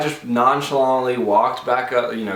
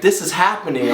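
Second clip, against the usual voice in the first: urgent nurses; 8 semitones higher, 5 dB louder than usual. Retold or imitated. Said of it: "telling, like".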